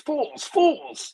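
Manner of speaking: high mock cry of an insult; hammed-up Frenchman scorning everyone; French character calling out. Mimicking the comic's French person mocking the losers, high hammed-up jeer. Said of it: "the French person calls every, all the losers".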